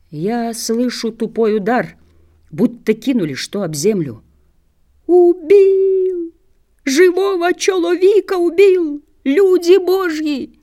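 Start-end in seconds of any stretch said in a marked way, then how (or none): 5.1-10.6 high, loud wail for help; drawn-out, high-pitched panicked wailing; desperate cry. A narrator's reading. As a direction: cry murder to the whole village. Beaten man cries out for help.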